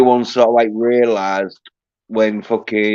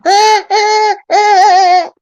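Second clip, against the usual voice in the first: very high, loud mock giggle; squeaky girly laugh; 7 dB louder, much slower than usual. Showing how they can't help it, squeaky girly laugh. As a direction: shriek out a silly girlish laugh. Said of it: "a really squeaky, girly laugh".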